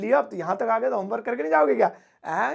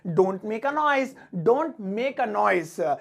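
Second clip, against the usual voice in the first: "Don't make a noise" said very slowly; very slow model phrase; much slower than usual. Shown as a demonstration. Teacher models the phrase very slowly to the class. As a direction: draw each word out for learners. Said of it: "इसे English में कहेंगे".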